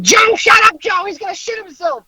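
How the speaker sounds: very high, mock-screeching New York Italian mother; screeching New York Italian mom. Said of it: "her screeching New York Italian voice".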